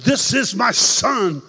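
loud, slow proclamation; voice of God. Retold or imitated. God the Father declaring at the baptism, loud and slow. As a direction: announce it with divine weight.

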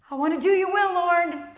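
high, earnest-sounding pledge; hammed-up devotion, pitched high; mock fervent prayer. Worshipper pledging to the Lord, high mock fervour.

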